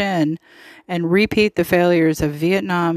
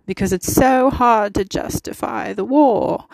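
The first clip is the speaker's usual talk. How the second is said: mock English accent; 3 semitones higher than usual. Mocking the allies' excuse.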